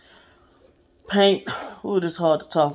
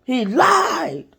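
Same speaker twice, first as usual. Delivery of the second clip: slow, much higher yelled cry of betrayal; angry child shout; 11 semitones higher, much slower than usual. Retold or imitated. Nine-year-old yelling at his foster mom that his dad lied. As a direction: shout it out as a hurt, furious kid. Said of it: "So I'm angry".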